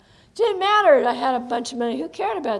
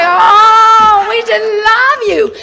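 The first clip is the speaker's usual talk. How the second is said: much higher and louder, gushing with a laugh; gushing adoring fan; 9 semitones higher, 12 dB louder, much slower than usual. Retold or imitated. Speaker mimics audience adoring her: high, loud gushing with a laugh. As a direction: mimic the audience swooning over you. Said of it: "You just looked at me like".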